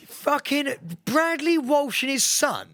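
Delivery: high, slow, drawn-out disbelief; slow incredulous exclamation. Real, not acted. Cohost savours the news of the new Gladiators hosts, slow, high and drawn out. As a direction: stretch every word out in disbelief. Repeating the shocking presenter news.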